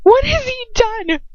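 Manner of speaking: high, slow, horrified; panicked scream. Acted. The narrator's memory self screaming at Lucius in panic. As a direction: cry out in horror. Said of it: "The memory of me is screaming".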